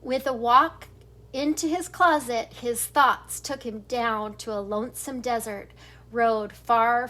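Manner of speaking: ordinary delivery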